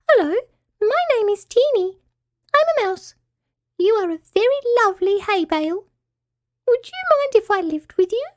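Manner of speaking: very high, tiny squeaky voice; high-pitched little mouse. A narrator's reading. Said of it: "said a high-pitched little voice".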